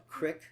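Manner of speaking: "crick" with a short i, rhyming with brick; local word crick. Shown as a demonstration. Oh, they call it "crick", short-i local pronunciation. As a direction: say "crick", short vowel, for creek. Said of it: "they call it crick".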